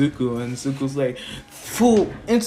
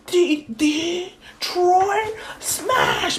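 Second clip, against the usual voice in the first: very slow, soaring mock yell; hammed-up superhero finishing move; joint attack cry; much slower than usual. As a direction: drag out each word, voice way up. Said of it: "They're like".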